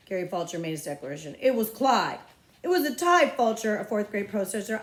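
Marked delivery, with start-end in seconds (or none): 1.4-2.2 louder insistence; insistent. Acted. Boy declaring winner. 2.6-3.8 high, loud protest. Acted. Protesting boy.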